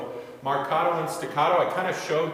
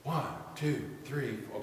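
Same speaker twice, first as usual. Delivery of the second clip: counting much softer; 8 dB quieter than usual. Shown as a demonstration. Conductor showing the choir a soft beat, counting quietly. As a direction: hush the count right down. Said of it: "That's soft".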